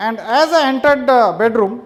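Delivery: normal talking voice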